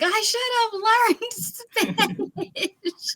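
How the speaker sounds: high, laughing self-quote; laughing regret. Retold or imitated. Recalling graduation regret, high laughing self-quote. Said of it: "I was like".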